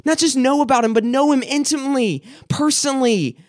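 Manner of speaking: climbing, fervent altar-call preaching; preaching at full force. Acted. Preacher telling the congregation God looks at them, fervent.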